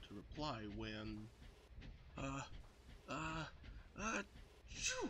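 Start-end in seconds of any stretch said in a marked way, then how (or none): 2.2-5.1 building "uh, uh, uh" into a sharp high sneeze. A narrator's reading. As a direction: wind up and sneeze hard. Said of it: "He sneezed".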